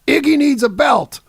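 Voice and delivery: high, loud imitated yell. Roadie yelling out the backstage door, urgent holler. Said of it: "he just yells out the door".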